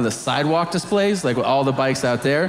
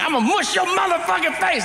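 much higher, fast, laughing mock threat; mock prisoner threat; 13 semitones higher, faster than usual. Comedian quoting the prisoner threatening the kid, fast high laughing voice. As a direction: blurt the prisoner's threat while cracking up. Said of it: "he's like".

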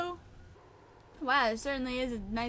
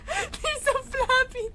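high, slow words through laughter; giggling; 13 semitones higher, 14 dB louder, much slower than usual. Real, not acted. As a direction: still laughing as you say it. Laughing at floppy corpse.

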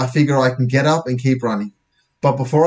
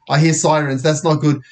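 plain reading voice

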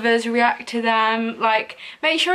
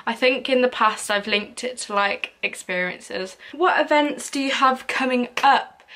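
usual speaking voice

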